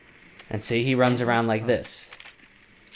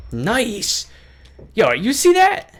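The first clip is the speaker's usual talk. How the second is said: high, loud gamer cheer; excited; 11 semitones higher, 4 dB louder, much slower than usual. Real, not acted. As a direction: shout it out after a great kill.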